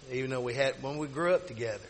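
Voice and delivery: usual speaking voice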